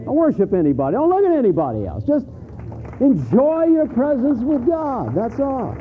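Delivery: high, ringing voice of God, slowing at the end; a hint of a commanding divine voice; voicing God. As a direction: proclaim it as God speaking to his people. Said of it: "And God is saying".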